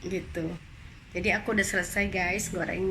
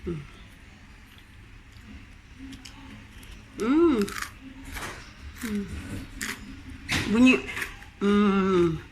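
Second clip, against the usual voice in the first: crunching chips; much slower than usual. Real, not acted. Still chewing the potatoes.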